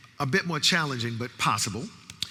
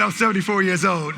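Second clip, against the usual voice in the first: retold audience reply; 5 semitones higher, 6 dB louder than usual. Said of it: "He said".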